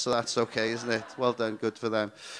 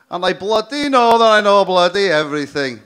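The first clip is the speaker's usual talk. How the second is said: loud, higher, brash Cardiff bluster; mock shout; 6 semitones higher, 14 dB louder than usual. Comic shouting the punchline at the crowd. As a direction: bellow it like a Valleys boyo.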